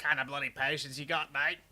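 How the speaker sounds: broad mock Aussie accent; jokey Australian bloke. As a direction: lay on a thick Australian accent. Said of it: "It's funny that the Australian accent".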